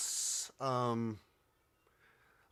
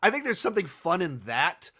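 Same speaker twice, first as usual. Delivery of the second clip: usual speaking voice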